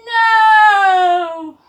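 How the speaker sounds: loud high no; crowd yell. Shown as a demonstration. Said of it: "Say it with me".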